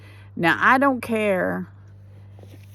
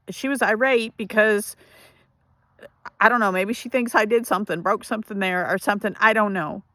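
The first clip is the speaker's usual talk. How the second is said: ordinary talk, nothing marked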